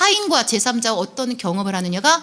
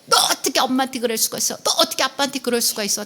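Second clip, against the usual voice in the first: fast, rattled-off mock scolding; mock parental reproach; 3 semitones higher, faster than usual. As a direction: reel it off like a parent reproaching a child. 자녀를 나무라는 부모 흉내. (자녀를 다그치듯 빠르게)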